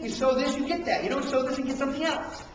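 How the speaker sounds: ordinary delivery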